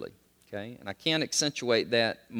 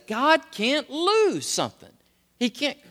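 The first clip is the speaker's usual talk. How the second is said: rising preacher's cry; 12 semitones higher, 4 dB louder than usual. Acted. Preaching climax.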